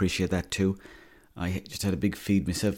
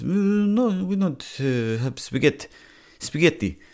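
mock Italian accent; 8 semitones higher, slower than usual. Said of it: "And he goes".

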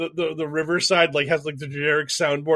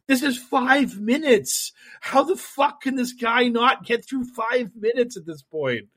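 higher, slowed, incredulous rant; exasperated disbelief; 7 semitones higher, slower than usual. Retold or imitated. Watching a Trump video, baffled he can't manage five minutes. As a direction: drag it out like you can't believe it. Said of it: "I was just like".

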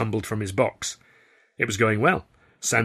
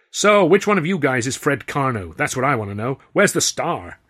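a loud, higher brash American showman; brash theatre owner; 4 semitones higher, 6 dB louder than usual. A narrator's reading. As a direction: demand the star like a pushy impresario. Grauman voiced by narrator.

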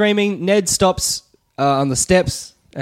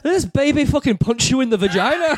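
high, hammed-up comic fury; mock outrage; 10 semitones higher than usual. Brother blames newborn for sister's injury, mock outrage. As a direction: rant in pretend fury.

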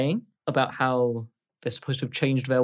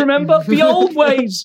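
tearful pleading; 14 semitones higher, 10 dB louder than usual. Acted. Sobbing Grode begs Tony to remember. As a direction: drag out the old ways through tears. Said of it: "Grode is looking at you crying now".

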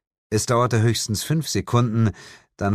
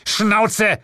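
loud, high bark; 5 dB louder than usual. A narrator's reading. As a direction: bark it to shut him up. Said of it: "bellte Asmodes". (laut angebellt)

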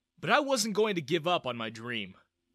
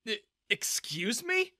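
slow, high, stammered disbelief; rising pitch, taking offence; affronted; 8 semitones higher, slower than usual. A narrator's reading. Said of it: "I glared at him".

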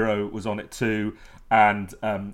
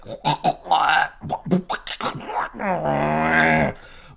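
a higher, louder earthy garbled croak with no words; cloddy Arthur noise; 7 semitones higher, 7 dB louder than usual. Retold or imitated. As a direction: gurgle it out like a mouth full of soil. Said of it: "King Arthur trying to say that sentence would say".

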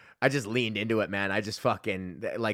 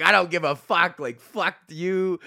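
louder, higher, dragged-out mock defiance at the crowd; mock defiant yell; 5 semitones higher, 4 dB louder than usual. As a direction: yell it back at the hecklers, slowing on the last words. Roasting a booing football team.

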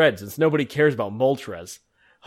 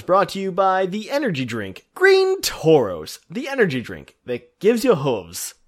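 ad announcer; 6 semitones higher than usual. Acted. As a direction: sell the brand name like a TV ad. Sponsor ad read.

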